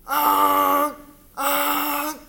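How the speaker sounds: high startled yelps; panicked cry. Retold or imitated. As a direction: yell in panic at the honking truck. Dream scream at the truck.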